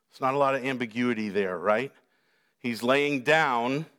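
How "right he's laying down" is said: ordinary talk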